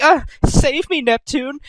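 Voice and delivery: high hammed-up cry for help; mock damsel cry. Noir crying out to Neptune for rescue, high hammed-up plea. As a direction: squeal it like a cartoon damsel. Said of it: "she's like".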